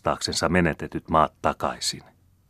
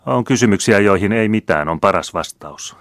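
offhand, casual reply, louder start; the count answers his brother offhandedly; indifferent; 4 semitones higher, 6 dB louder than usual. A narrator's reading. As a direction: brush it off indifferently. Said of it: "vastasi kreivi Bernhard välinpitämättömästi".

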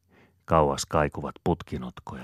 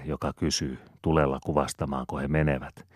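plain reading voice

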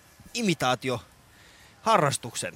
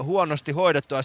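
ordinary talk

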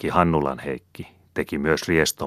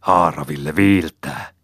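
a louder hissed charm; hissing; 5 dB louder than usual. A narrator's reading. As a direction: hiss the spell as the knife cuts. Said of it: "sähähti".

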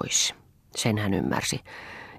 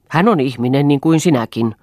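louder, heated shout; a husband's raised voice in a quarrel; 3 semitones higher, 6 dB louder than usual. Acted. Husband shouting at his wife over the maid. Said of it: "huusi Eero".

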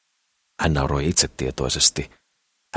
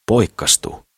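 a mocking, higher echo of poikkastu with a falling end; 6 semitones higher, slower than usual. A narrator's reading. Old woman mocking Sakris's word poikkastu. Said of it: "jatkoi Muori ivallisesti ja uteliaasti".

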